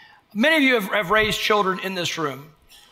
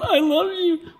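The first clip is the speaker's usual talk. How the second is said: put-on baby talk; 8 semitones higher, slower than usual. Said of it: "warm fuzzies going".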